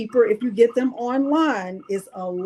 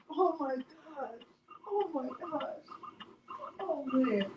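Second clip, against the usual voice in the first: much softer, fading wonder; overcome, hushed; 4 semitones higher, 11 dB quieter than usual. Real, not acted. Absorbing a friend's good news, hushed. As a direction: let it trail into quiet awe.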